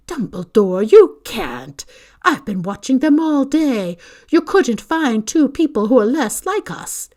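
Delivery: loud, high, indignant tirade; outraged protest. A narrator's reading. McGonagall objects to Dumbledore leaving Harry there, outraged. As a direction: hammer every objection home.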